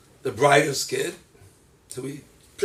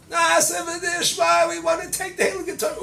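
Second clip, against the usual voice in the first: much higher, eager cry of acceptance; eager people; 14 semitones higher, 5 dB louder than usual. Acted. Israel answering Hashem, high and eager. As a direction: answer like excited believers.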